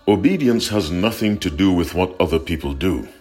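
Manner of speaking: ordinary delivery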